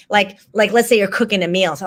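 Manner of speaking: usual speaking voice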